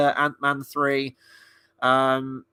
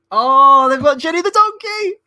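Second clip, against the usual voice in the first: high, loud burst of delight; excited shout; 11 semitones higher, 10 dB louder than usual. Real, not acted. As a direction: cry out in sudden glee at the donkey. Spots the donkey on stage.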